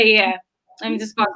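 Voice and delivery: ordinary talk, nothing marked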